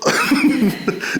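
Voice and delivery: short high-pitched giggle; laugh. Real, not acted. Speaker retelling the sweat lodge ordeal, short high laugh. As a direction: giggle in the middle of your story.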